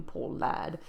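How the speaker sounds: a groaned poor lad, voiceless; groaning. Acted. Joseph groaning over the poor lad, half-whispered. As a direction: sigh it out in pity. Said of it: "he added with a groan".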